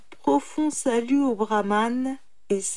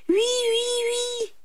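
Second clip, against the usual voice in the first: very high repeated yeses; impatient yes; slower than usual. A narrator's reading. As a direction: confirm eagerly, pitch way up. Man hurriedly confirms to slow jackal. (oui pressé et très aigu)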